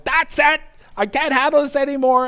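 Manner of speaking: high, loud, fed-up cry of giving up; exasperated outburst. Retold or imitated. Husband storming out of the house over the blender, exasperated shout. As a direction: throw up your hands and yell it.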